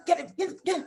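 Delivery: high-pitched mock bulldog at the door; bulldog-like snapping. Imitating the lady at the door, like a bulldog. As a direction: imitate a rude clerk as a yapping bulldog. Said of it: "she was like a bulldog".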